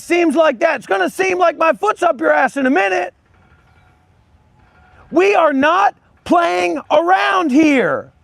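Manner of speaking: furious yell at a mouthy viewer; shouting. Real, not acted. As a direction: shout him down hard. Yelling at a viewer.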